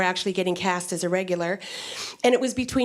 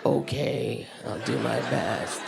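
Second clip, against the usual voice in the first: much lower, mock deep voice; lower register; 11 semitones lower, 3 dB quieter, slower than usual. Shown as a demonstration. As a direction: drop into a put-on deep man's voice. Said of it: "speak in your lower register".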